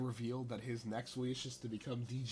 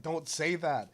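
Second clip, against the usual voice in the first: exasperated; 5 semitones higher, 8 dB louder than usual. Retold or imitated. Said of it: "it's like".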